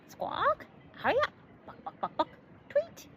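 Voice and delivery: high squawking bird voice with clucks; bird talking. A narrator's reading. Narrator voicing the bird calling from the roof, high squawks. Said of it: "what sounded like a bird talking".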